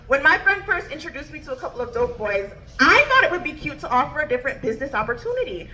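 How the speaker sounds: ordinary delivery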